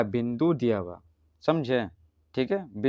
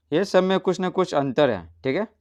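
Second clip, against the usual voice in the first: ordinary talk, nothing marked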